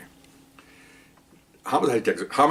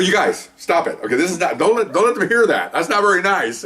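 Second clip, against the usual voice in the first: mock scolding; 9 semitones higher than usual. Teller's mock scolding of partners.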